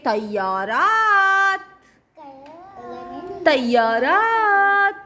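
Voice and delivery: slow drawn out. Shown as a demonstration. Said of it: "modelling the plural".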